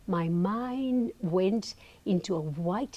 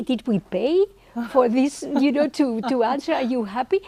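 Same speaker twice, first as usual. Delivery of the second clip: louder, higher complaining protest; mock outrage, raised voice; indignant retort; 5 semitones higher, 5 dB louder than usual. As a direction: complain as if cheated of your money. Her indignant retort to the guru.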